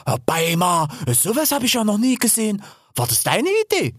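amazed. A narrator's reading. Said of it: "untersuchte den Bau staunend".

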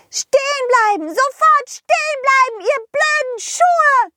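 very high furious fairy yell; angry fairy shout. Acted. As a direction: scream at the runaway shoes. Fee ruft den Schuhen nach. (wütend kreischende Fee)

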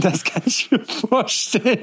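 high, slow laugh through the words; amused laughter while speaking. Real, not acted. Lacht über Geständnis. (lachend, hoch und langsam)

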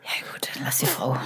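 hushed whisper; whispered aside. Real, not acted. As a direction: drop to a whisper. Teasing aside about grandma.

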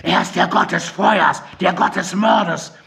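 panicked raving. A narrator's reading. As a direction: cry it out to the sky in panic. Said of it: "in namenloser Panik".

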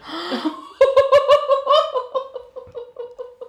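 long breathless laughing fit; breathless run on. Real, not acted. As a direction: laugh until you run out of air. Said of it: "[name], hol Luft".

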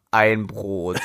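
a hint of a low, glum prisoner; deadpan condemned man. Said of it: "In dem Ton".

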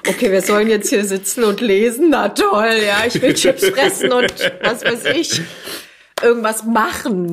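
loud, whiny bored complaint; bored kids. Retold or imitated. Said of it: "fanden's so ein bisschen öde".

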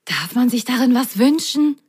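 wishful, excited question; excited mock whisper. A narrator's reading. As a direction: ask eagerly, as if sharing a secret. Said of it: "Flüsterte er aufgeregt".

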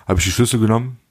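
normal talking voice

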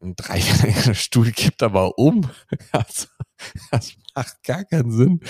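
talking through laughter; laughing. Real, not acted. Amused at a three-legged stool tipping over, laughing. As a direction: let laughter break up the words.

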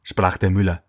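ordinary delivery

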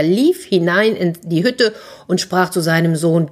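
ordinary delivery, nothing marked

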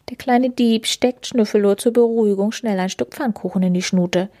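plain reading voice

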